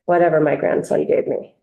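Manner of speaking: ordinary talk, nothing marked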